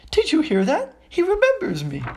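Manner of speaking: very high, giddy boasting to the room; thrilled, high-pitched exclaiming; excited cry. A narrator's reading. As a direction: squeal it proudly to everyone. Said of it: "cried D-, Daedalus Diggle".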